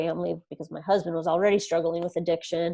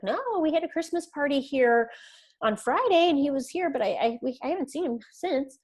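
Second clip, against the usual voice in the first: higher-pitched imitation of a roommate; lightly voiced roommate; 8 semitones higher than usual. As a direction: answer lightly in a raised voice. Voices the roommate's casual answer.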